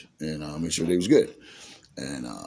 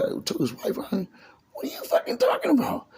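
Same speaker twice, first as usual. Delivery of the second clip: softer, climbing to a very high squeak; incredulous protest; 3 dB quieter than usual. Retold or imitated. Narrator accused of taking Duke's wife, high incredulous protest. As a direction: act out disbelief at an absurd accusation.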